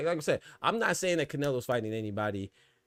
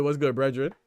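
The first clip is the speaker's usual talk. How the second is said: usual speaking voice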